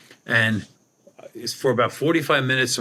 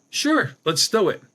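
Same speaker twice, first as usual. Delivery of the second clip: higher, eager retold yes; eager agreement; 9 semitones higher than usual. Retelling his eager reply to the ranger's invitation, higher. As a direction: jump at the offer.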